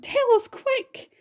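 high, drawn-out urgent cry. A narrator's reading. Earthworm pressing James to tell the plan, high urgent cry. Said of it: "cried Earthworm".

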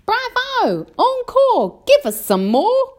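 high, loud cheering for an encore; excited shout. A narrator's reading. Miss Spider cheers the grasshopper's playing, excited shouting. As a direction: call out your applause at the top of your pitch. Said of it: "Miss Spider stood up and shouted".

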